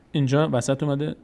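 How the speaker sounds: usual speaking voice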